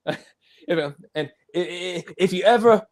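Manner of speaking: imitating a hesitant tough talker; unsure of himself. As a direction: stumble through a threat you barely believe. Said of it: "he's just so unsure of himself".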